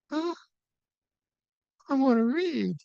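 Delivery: high whiny young monk, imitating; hammed-up high 'I wanna read'; mock protest. Young monk's retold protest.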